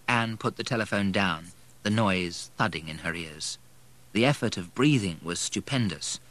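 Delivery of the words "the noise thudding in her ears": ordinary delivery, nothing marked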